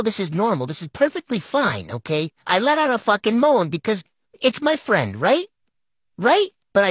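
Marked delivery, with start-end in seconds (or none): 6.2-6.5 insistent questioning. Real, not acted. Storyteller seeks backup on the moan, high insistent "Right?". Repeats it, pressing for agreement.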